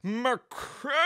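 high, whining "McCrimmon"; Zeke's Christmas. Retold or imitated. Imitating Zeke repeating "McCrimmon" when asked again. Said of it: "What'd you say".

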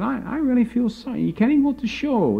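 high, mock-pitying friend's voice; pitying [name]. Coworker pitying the narrator, imitated high and sorry.

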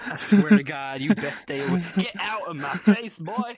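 loud menacing warning; angry threat. Acted. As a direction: bark it as a tough-guy threat. Threatening someone with a camera.

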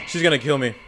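normal talking voice

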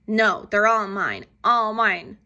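loud possessive yell; scream. A narrator's reading. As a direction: scream it out, all mine. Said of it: "screamed the grouchy ladybug".

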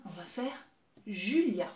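ordinary talk, nothing marked

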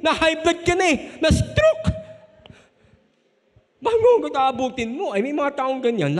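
loud, high, building preacher's delivery; impassioned preaching. Real, not acted. Preacher scolding the congregation about always being angry, impassioned and loud. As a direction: preach it at full force, pitch climbing.

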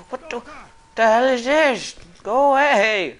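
high, loud alarmed yelling; panicked shouting. Real, not acted. Gamer panicking at an enemy on him, loud high yelling. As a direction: yell at the attacker to back off.

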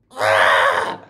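low, loud scream; horrified scream. Acted. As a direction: scream in shock. Scream in horror.

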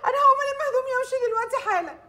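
crying shout. Acted. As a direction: blurt out she's leaving while crying. Bride threatens to leave, crying. (عروسة بتزعق وهي بتعيط إنها ماشية)

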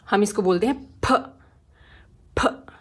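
फ as a puff between p and f; p-f mix sound. Shown as a demonstration. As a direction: puff फ from closed lips. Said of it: "थोड़ा mix sound रहती है".

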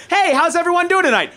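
a club emcee opening the show; upbeat comic host. Retold or imitated. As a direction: greet the crowd like a cheery host.

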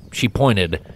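usual speaking voice

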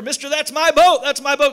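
loud, high, excited acted claim; urgent boy's plea. Boy to toy store owner on finding his boat, acted urgent cry.

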